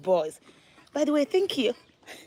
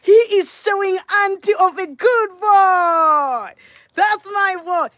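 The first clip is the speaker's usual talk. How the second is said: slow, loud and higher proud cheering; proud hype; 6 semitones higher, 5 dB louder, slower than usual. Real, not acted. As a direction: hype him up for the camera. Mom hyping son for camera.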